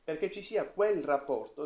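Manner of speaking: usual speaking voice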